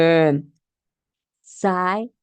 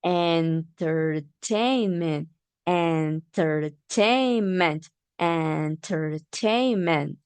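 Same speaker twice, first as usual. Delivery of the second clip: entertainment said slowly, syllables apart; slow syllable drill; slower than usual. Shown as a demonstration. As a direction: stretch entertainment out for listeners. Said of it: "we are going to see".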